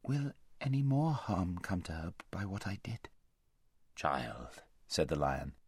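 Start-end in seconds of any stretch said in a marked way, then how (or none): 0.0-3.1 near-whispered worry; hushed, timid question. A narrator's reading. Girl fearfully asks great lion. 3.9-4.6 very low, soft lion; gentle rebuke. A narrator's reading.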